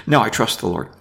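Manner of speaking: normal talking voice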